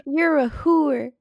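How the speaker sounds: slow teasing laugh; laughing tease. Real, not acted. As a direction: laugh and drawl it out. Teasing agreement.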